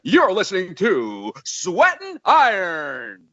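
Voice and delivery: high, loud, long-drawn announcer call; ring announcer. Acted. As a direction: belt out the show intro like a wrestling announcer. Announces the show in ring announcer style.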